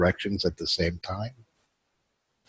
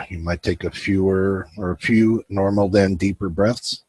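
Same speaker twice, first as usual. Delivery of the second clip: guided meditation; 3 dB quieter than usual. Acted. Host guiding his viewers into relaxation, calm guided meditation. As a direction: ease the listeners into stillness.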